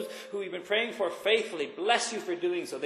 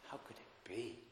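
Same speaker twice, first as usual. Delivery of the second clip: hushed wonder; 7 semitones lower, 18 dB quieter than usual. Shown as a demonstration. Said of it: "full of wonder".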